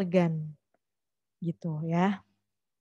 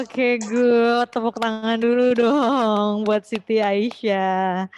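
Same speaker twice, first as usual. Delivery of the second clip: loud, drawn-out cheer; rally the room, stretching the words; calling for applause; 6 dB louder, much slower than usual. Real, not acted. Teacher asking the class to applaud a student, loud rallying call.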